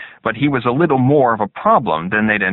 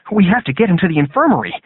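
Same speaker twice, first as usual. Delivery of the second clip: urgent; 10 semitones higher, 4 dB louder, faster than usual. A narrator's reading. Recruit urges help after beating.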